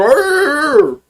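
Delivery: high, loud mock bark "Arr"; imitated dog bark. Retelling how his dog burst in, a mock high bark. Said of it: "he just came in and go".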